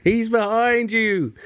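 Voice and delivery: slow laughing panto shout; panto crowd callback. Acted. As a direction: call out like a panto audience. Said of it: "It's turning into a pantomime".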